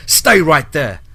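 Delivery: yelled order. Acted. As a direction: freeze them with a yell. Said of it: "he yelled".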